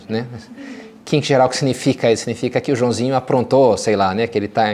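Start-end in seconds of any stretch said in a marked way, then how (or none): none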